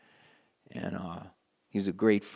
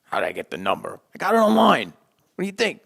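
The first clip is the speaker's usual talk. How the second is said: mock indignant Pete; 8 semitones higher, 8 dB louder than usual. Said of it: "He was like".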